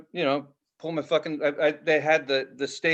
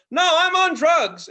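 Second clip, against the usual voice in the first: high, loud, drawn-out protest; shouting back; 13 semitones higher, 7 dB louder, slower than usual. Retold or imitated. Festivalgoer corrects the comic onstage, high slow shout. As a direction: holler it up at the stage in mock indignation.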